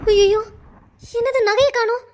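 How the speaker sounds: stunned, as the loss sinks in; alarmed disbelief. Acted.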